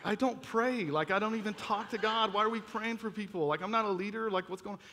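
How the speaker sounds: bewildered protest. Retold or imitated. Teen balking at being asked to pray for people, bewildered protest. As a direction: protest as a retold out-of-his-depth teenager.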